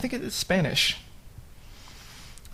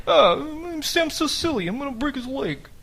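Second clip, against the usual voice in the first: high, put-on dumb brawler voice, then quieter; mock fighter voice; 9 semitones higher, 4 dB quieter than usual. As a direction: lightly caricature a tough fighter. Mock fighter voice, quoting Sam.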